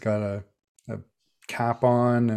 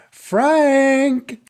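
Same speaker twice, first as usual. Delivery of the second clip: high, loud call across the street; 8 dB louder than usual. Acted. Robin leans over Rosemary and hails Frank, high loud call. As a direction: shout the name to get his attention.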